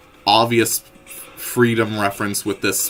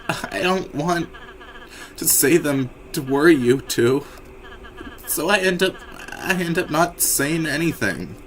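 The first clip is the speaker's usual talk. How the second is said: timid Ralsei; 6 semitones higher than usual. Acted. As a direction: stumble through the confession. Ralsei apologizing.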